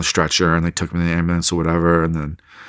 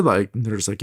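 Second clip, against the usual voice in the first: ordinary delivery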